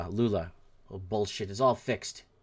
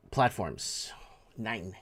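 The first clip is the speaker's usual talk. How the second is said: ordinary talk, nothing marked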